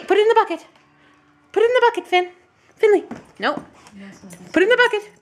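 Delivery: calling out firm commands to a puppy; loud commands to dog. Real, not acted. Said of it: "yell like this on YouTube".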